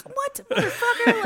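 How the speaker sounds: high, loud, laughing disbelief; mock outrage. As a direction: squawk it in hammed-up indignation. Acted outrage at a lukewarm response.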